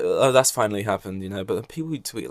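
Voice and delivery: usual speaking voice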